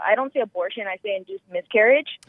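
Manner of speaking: normal talking voice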